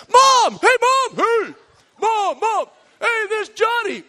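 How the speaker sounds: high, loud mock holler; hammed-up excited shouting to family; calling out to the stands. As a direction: yell for Mom across a packed stadium. Player hollers to people in stands.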